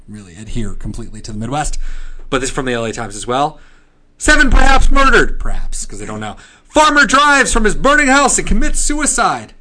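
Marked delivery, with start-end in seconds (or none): 4.2-5.4 high, loud newsboy hawking headlines; old-timey paperboy crying the news; newspaper kid. Pretend. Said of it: "the newspaper voice". 6.6-9.5 high, loud newsboy hawking headlines; old-timey paperboy crying the news; newspaper kid. Pretend. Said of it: "the newspaper voice".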